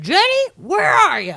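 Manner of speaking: high, loud, drawn-out mock holler; angry dad calling. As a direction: imitate a father flipping out as he calls. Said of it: "you can hear the dad being like".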